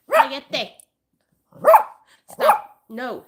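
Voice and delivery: loud, high sharp command to the dog; scolding yell. Real, not acted. Owner yelling at her barking dog to hush, sharp and loud.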